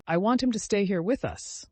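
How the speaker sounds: high, firm, commanding; peremptory. A narrator's reading. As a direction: lay down the law to the room. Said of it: "she said peremptorily".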